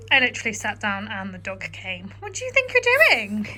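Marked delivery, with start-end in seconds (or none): 2.2-3.5 high teasing telling-off of the dog; mock scolding. Real, not acted. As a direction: scold the dog playfully, pitched up.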